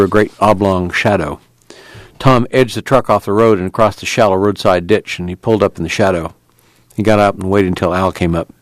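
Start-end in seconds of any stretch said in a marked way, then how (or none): none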